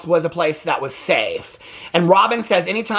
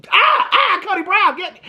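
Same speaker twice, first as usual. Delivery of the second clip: very high breathy mock moan; mock swooning wife; 13 semitones higher than usual. Recapper mimicking Janelle lusting after Cody, hammed-up swoon. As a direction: ham up a swooning grab. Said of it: "she just grabbed him while she".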